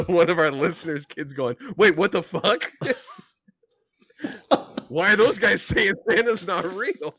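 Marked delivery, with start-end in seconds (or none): 1.8-7.1 put-on high, loud indignant kid voice; mock shocked kid. Listener's kid hearing the podcast, mock outraged. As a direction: play a stunned child asking why.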